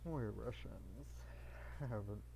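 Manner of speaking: low put-on Russian figure; Russian character. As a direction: speak low as a proud Russian. Voices a Russian figure in the game.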